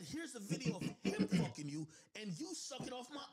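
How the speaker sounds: can't keep a straight face; real laugh mid-accusation; laughing while exposing. Real, not acted. Showing her the video.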